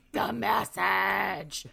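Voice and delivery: very slow, loud gurgling croak; gurgled message. Acted. Dying messenger insists to Fitz, slow loud croak. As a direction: choke the words out again. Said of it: "she just gurgles".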